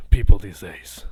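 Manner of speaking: exasperated grumble. Acted. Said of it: "Steros looks back at you and says".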